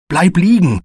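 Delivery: loud, high-pitched warning cry; urgent call. A narrator's reading. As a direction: call out a sharp command to a sibling in danger. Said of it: "rief Nisir". (laut und hoch warnend rufen)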